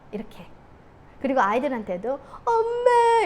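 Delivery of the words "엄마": mock child's wail. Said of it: "이러면서".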